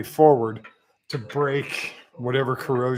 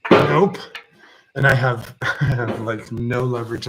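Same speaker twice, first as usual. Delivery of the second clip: strained, louder effort on tiptoes; reaching up and straining; 6 dB louder than usual. Real, not acted. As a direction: push the words out while straining. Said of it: "I'm doing this one-handed on my tiptoes".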